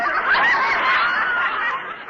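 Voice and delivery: high burst of giggling laughter; a sudden high giggle after a slip of the tongue; embarrassed laugh. Acted. Slip calling him Knucklehead.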